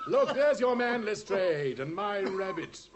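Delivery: loud, high exultant announcement; triumphant reveal. Acted. Holmes triumphantly shows Lestrade the hidden man, loud and high. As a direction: crow over the unmasked culprit.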